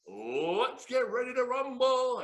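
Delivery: high, loud, slow ring announcer boom; Michael Buffer. Retold or imitated. Ring announcer calling out to the bar crowd. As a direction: stretch it out like a boxing ring announcer. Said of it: "I do that voice like Michael Buffer".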